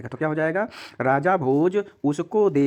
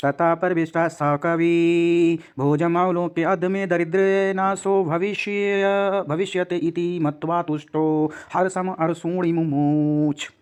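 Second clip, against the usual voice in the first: slow, chanted verse reading; Sanskrit recitation; 3 semitones higher, slower than usual. Shown as a demonstration. Teacher reciting a Sanskrit verse to students, slow chant. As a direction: recite the shloka slowly and evenly.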